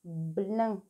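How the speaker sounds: "Blin" with a clear b, then a held l; first sound marked. Shown as a demonstration. Teacher warns about the first sound, "Blin" with a clear b. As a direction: set off the b in "Blin", linger on the l. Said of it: "Attention, le premier son, c'est la botte".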